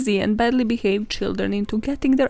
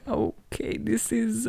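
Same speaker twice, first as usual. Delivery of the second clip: hushed shock; 5 dB quieter, slower than usual. Retold or imitated. Both reacting to pigeon collapse.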